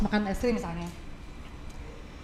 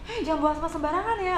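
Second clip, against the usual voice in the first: scolding stranger; 7 semitones higher than usual. Retold or imitated. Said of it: "nggak yang gini".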